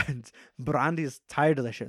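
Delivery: normal talking voice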